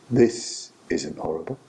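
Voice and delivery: low, calm voice with long pauses; quiet, measured reassurance out of the dark; voice from darkness. Retold or imitated. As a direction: answer softly and low, unhurried. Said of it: "this voice comes from the dar-, darkness".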